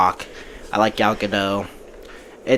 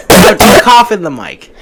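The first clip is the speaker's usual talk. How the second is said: raised voice, telling him off; loud scolding; 6 semitones higher, 10 dB louder than usual. Real, not acted. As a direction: snap at him to stop. Scolding friend coughing into mic.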